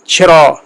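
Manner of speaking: چھرا with a breathy aspirated ch; چھرا, modern Persian aspirated ch. Shown as a demonstration. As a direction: puff air on the ch of چھرا. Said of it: "اس طرح چرا".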